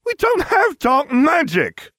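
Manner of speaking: a high, loud, slow alarmed yelp; boy protesting his family's innocence. A narrator's reading. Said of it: "Ron yelped".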